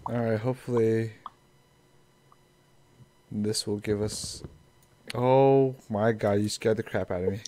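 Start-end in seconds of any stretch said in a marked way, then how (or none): 5.1-6.4 sudden loud startled cry; startled gasp. Real, not acted. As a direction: jump out of your skin. Startled by friend sneaking up.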